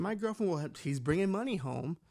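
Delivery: ordinary talk, nothing marked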